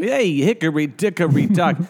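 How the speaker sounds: loud, brash caricature; mock boorish voice. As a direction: ham up a crude guy. Mocking Trump's voice.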